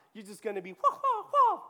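a mocking tantrum wail. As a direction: cry wah wah like a tantrum. Mocking a flailing fighter.

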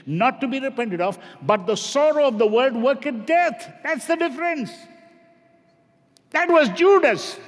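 high, loud preacher's cry; full-force sermon delivery; fiery preaching. Real, not acted.

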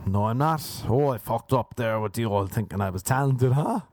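usual speaking voice

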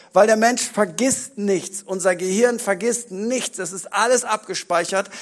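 ordinary talk, nothing marked

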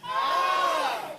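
high cry on the count; high scream. Shown as a demonstration. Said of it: "Eins, zwei, drei". (hoher Schrei nach dem Anzählen)

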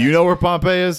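high, teasing repeat; mocking echo. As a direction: parrot his question back.

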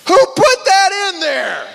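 high, loud, slow astonished cry; amazed exclaim. Retold or imitated. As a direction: burst out in mock amazement. Amazed at a familiar verse.

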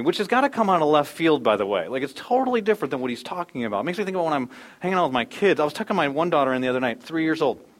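usual speaking voice

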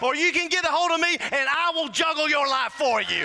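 fired-up, building sermon delivery; excited preaching. Real, not acted. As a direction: preach it with rising, insistent fervor. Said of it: "I just got excited".

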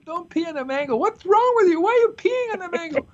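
very high, hammed-up outrage; high-pitched mock-exasperated telling-off; mock scolding. Acted scolding of garden guests.

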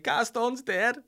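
high, loud wail of grief; mock grief. Telling the shop staff his fish died, mock wail. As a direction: ham up the grief. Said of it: "was like".

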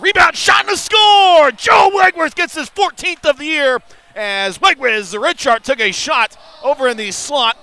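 high, loud, stretched goal call; goal call shout. Real, not acted. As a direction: shout the score to the crowd. Goal call in hockey broadcast.

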